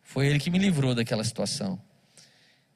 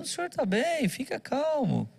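high, soft and slow, almost sweet; exaggerated gentle plea to a furious driver; meek calming; 10 semitones higher, 4 dB quieter than usual. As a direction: soothe the angry man like a gentle lamb. Meekly calming the cursing driver.

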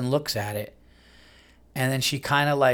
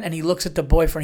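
ordinary talk

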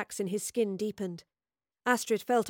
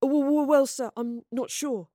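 nervous stammer; 5 semitones higher, 4 dB louder than usual. A narrator's reading. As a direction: stumble over the first word, nervous. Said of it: "stammered Gaius".

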